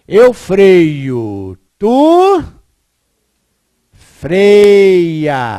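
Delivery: "freio" and "freias" loud with the fre stretched; emphatic conjugation. Shown as a demonstration. Teacher to class, belting out the conjugation. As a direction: belt out each form, pressing on fre. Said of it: "Tá vendo?".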